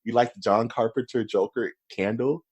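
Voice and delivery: normal talking voice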